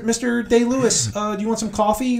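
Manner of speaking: put-on high, hesitant young assistant voice; timid intern. Intern to a method actor, high and hesitant. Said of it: "the intern, like".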